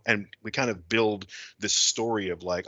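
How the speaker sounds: ordinary talk, nothing marked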